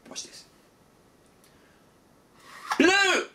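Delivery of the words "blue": calling out to make the cube listen; shouted colour. Pretend. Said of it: "I'm gonna shout out blue".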